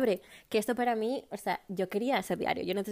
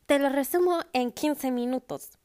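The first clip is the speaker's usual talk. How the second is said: higher, louder announcer-style channel intro; hammed-up YouTuber catchphrase; YouTube summary channel host; 5 semitones higher, 3 dB louder than usual. Imitating that summary channel's host, announcer style. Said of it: "como el canal ese de".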